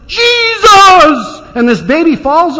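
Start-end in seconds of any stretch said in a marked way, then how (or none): 0.1-1.4 high, loud cry of Jesus; frantic holler. Retold or imitated. Mother braking on the freeway, frantic cry to God. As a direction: scream it as the child falls toward traffic. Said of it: "hollers at the top of her voice".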